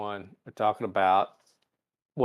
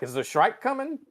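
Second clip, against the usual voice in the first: lightly voiced Uhura; 10 semitones higher than usual.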